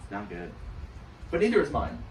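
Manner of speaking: ordinary talk, nothing marked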